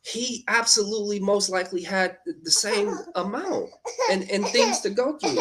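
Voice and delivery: normal talking voice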